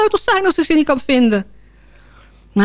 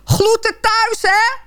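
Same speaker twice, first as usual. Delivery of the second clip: calling after someone; 11 semitones higher, slower than usual. Retold or imitated. Couple send off the dismantled phone, calling after it. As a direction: call it out high as a mock goodbye. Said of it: "we riepen hem nog na".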